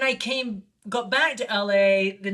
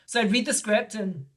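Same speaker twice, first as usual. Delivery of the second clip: ordinary delivery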